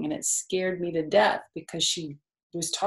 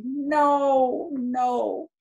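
higher, louder mock cat protest; cat saying no; 7 semitones higher, 5 dB louder than usual. Imitating her cat refusing the car ride. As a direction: whine no like a cat off to the vet. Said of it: "she'll say".

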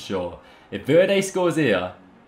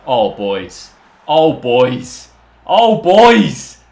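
building elation; 10 dB louder, much slower than usual. Real, not acted. Gamer to viewers after a late equaliser, elation building to a shout. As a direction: repeat it louder each time, ending in a roar. Stunned celebration of late goal.

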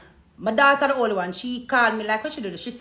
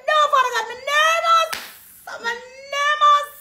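mock nervous cry; 6 dB louder than usual. Retelling panic, praying aloud.

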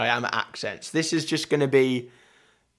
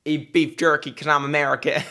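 put-on Texan American accent. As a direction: do a broad Texas accent. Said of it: "What kind of American am I gonna do?".

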